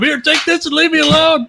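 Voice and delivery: high, panicked brush-off. Pretend. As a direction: shove it away in terror. Voicing Matt throwing life vest.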